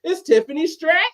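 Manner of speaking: a light imitation of a ring announcer's rising call; Barbie announcer inflection. Shown as a demonstration. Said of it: "it's almost the same inflection, but she has to lower it".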